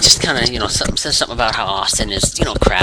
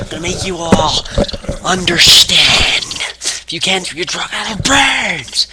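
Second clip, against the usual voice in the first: much higher, very slow seething threat; snapping in rage; 7 semitones higher, slower than usual. Retold or imitated. Wrestler snapping at the whole crowd, slow seething rage. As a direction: drag each word out like he's finally cracked. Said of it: "he snaps".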